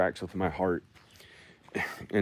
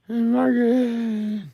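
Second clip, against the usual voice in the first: mock high voice; 5 dB louder than usual. Mock voice naming Ann-Margret.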